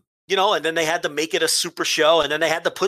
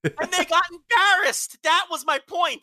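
impassioned rant; 13 semitones higher than usual. Real, not acted. Host insisting to co-host on his point, exasperated. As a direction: drive the point home, pitched way up.

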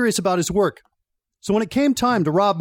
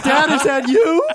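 cheeky jab at the cop while laughing; smart-ass quip; 8 semitones higher than usual. Retold or imitated. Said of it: "I go, I go".

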